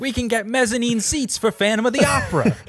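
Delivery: high, bright-eyed tourist gushing; excited tourist. Pretend. Lions imagined as Broadway tourists, gushing tourist voice. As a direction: play a thrilled Broadway tourist.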